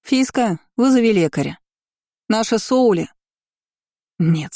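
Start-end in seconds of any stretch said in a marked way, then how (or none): 0.0-3.1 higher-pitched call through a door. A narrator's reading. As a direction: call out to someone in another room, without full volume. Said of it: "крикнула в распахнутую дверь".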